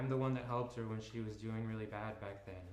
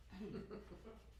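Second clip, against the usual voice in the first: bitter laugh; 9 semitones higher, 10 dB quieter than usual. Acted. Ellie angry on the phone.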